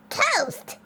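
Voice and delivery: high exclamation, falling sharply; grumbled. A narrator's reading. Worried neighbor scoffs at butter some toast, grumbled exclamation. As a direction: grumble it in disbelief. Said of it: "grumbled Yesenia".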